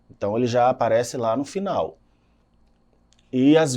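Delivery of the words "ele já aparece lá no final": ordinary talk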